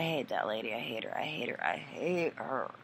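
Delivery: muttered hatred. Real, not acted. Repeated venting, much quieter.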